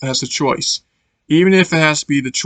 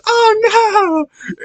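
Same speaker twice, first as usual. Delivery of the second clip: much higher, hammed-up crying "oh no"; over-the-top wail. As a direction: wail it like a melodramatic cartoon pup. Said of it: "It's so over the top".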